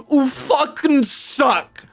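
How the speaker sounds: usual speaking voice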